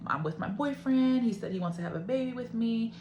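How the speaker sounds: ordinary talk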